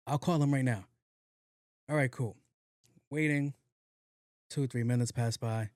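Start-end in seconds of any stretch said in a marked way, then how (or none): none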